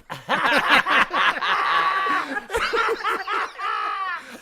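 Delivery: loud, high, helpless laugh; big burst of laughter. Real, not acted. Teller laughs at his own story, loud and high. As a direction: crack up loudly at the punchline.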